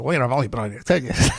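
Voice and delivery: dry sarcastic retort; mild sarcastic comeback. Retold or imitated. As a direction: say it with a sarcastic edge. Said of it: "wanted to say sarcastic".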